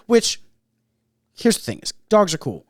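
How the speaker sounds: usual speaking voice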